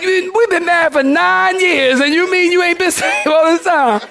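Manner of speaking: high, laughing mock outrage; mock disbelief. Husband teases his wife of nine years, mock disbelief. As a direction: act scandalized while cracking up.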